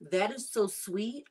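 ordinary talk, nothing marked

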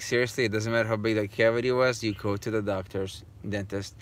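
ordinary talk

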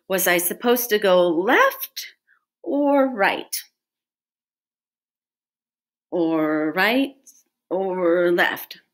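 puzzling over a choice, drawn-out "or"; thinking hard. A narrator's reading. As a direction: weigh it up, unsure. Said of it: "He thought as hard as he could".

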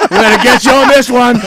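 high, loud joking villain; hammed-up threat breaking into laughter; mock stalker. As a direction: play the creep for laughs, loudly.

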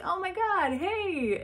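high, excited squeal of greeting; delighted surprise. Retold or imitated. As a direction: squeal back at a friend. Greets friend back with delight.

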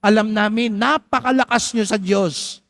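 high, pleading reporter asking a favour; a reporter begging Mother Teresa for her secret; eager reporter. Retold or imitated. Reporter asking Mother Teresa, eager and pleading. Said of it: "sabi nung reporter".

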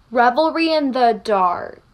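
ordinary talk, nothing marked

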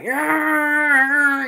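high, loud rocker cry; rocker yeah. Retold or imitated. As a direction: belt it like a rock star on stage. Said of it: "he be like".

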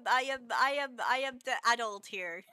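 ordinary talk, nothing marked

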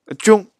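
军 said high and loud, stretched for the class; dialect sound demo. Shown as a demonstration. Teacher repeating the sound for students, pitched high. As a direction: repeat 军 sharply and high so learners catch it. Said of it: "军发是军的音".

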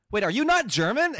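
loud, high-pitched incredulous question; retold astonishment. Learner realizing the other person isn't German, loud astonished question. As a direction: act out sudden disbelief, voice shooting up. Retold mutual surprise at discovery.

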